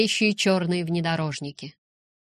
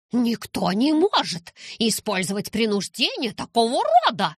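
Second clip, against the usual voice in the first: a touch higher, indignant exclamation; exclaiming; 4 semitones higher, 3 dB louder than usual. A narrator's reading. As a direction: protest it in disbelief. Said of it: "воскликнула Кирова".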